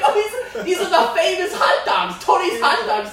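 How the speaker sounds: high, loud, laughing exaggerated awe; mock starstruck tourist. Comic as tourists photographing the stand, high mock excitement. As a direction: gush loudly like a thrilled sightseer. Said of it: "people from Thailand and China".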